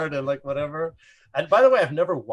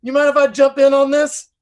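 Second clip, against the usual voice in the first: high, loud eager bro ask; 12 semitones higher, 11 dB louder than usual. Retold or imitated. [name] asking to join the crew, hammed-up eager. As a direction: jump in with cheerful bravado. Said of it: "and he goes".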